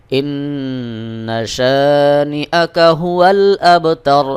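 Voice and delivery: slow melodic tajweed chant; ধীর সুরেলা তিলাওয়াত; Quran recitation. Shown as a demonstration. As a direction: draw out every vowel in recitation. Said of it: "প্রথম দুই আয়াত একসাথে আবার পড়বেন".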